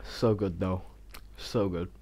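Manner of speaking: talking while chewing; words round a bite of food; mouth full. Real, not acted. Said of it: "'cause I was eating food".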